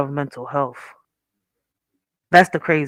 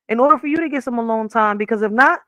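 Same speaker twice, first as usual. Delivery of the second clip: normal talking voice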